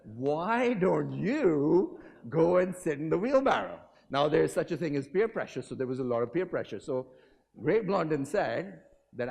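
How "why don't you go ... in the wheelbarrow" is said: mock sarcastic bystander; crowd retort. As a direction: throw the dare back at him. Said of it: "They said".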